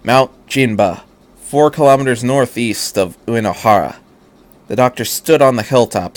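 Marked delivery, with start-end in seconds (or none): none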